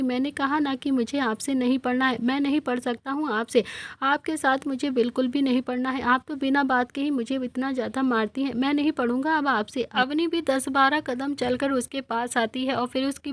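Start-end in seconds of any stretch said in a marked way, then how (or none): none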